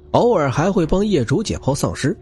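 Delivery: ordinary delivery, nothing marked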